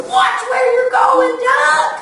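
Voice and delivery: squawky warning to Duck; annoyed chicken shout. Acted. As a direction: yell it as a cross hen.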